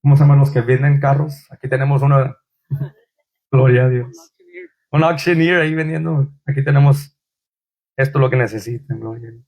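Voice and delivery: lightly voiced car salesman pitch; mock salesman. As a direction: hawk it like a car dealer. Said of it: "Creo que me voy a hacer". (vendedor de carros en broma)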